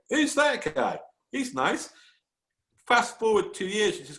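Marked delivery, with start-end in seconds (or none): 0.1-1.9 high-pitched imitation of a smitten woman; admiring young woman. Wife spots a stranger in the newsreel, high admiring imitation. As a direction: voice her interest, pitched well up. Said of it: "but she's like".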